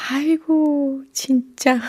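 high-pitched, breaking into giggles; real amused laughter. Real, not acted. Radio host amused by listener's new hairstyle mishap, laughing. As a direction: talk through helpless laughter, voice lifted high.

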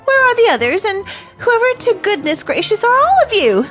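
high, startled questioning; startled nursemaid. A narrator's reading. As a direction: demand to know who they are, pitch up. Nursemaid fails to recognise children.

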